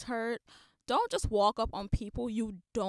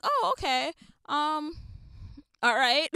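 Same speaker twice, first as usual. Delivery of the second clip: awkward mock reply; 6 semitones higher, 7 dB louder, slower than usual. Her awkward reply to the text.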